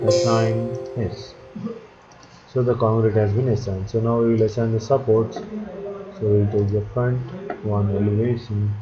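normal talking voice

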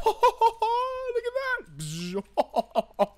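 high, stretched-out laughing delight; real giddy laughter. Real, not acted. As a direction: laugh the words out slowly, overjoyed. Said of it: "I'm a little bit excited".

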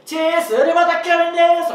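big loud voice. Retold or imitated. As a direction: project it to the back of the set. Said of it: "でかい声で". (でかい声で張り上げる)

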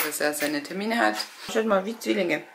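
ordinary talk

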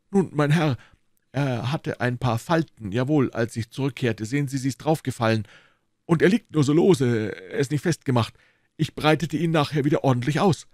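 a guilty constable pleading innocence; mildly voiced, embarrassed guard; sheepish constable.

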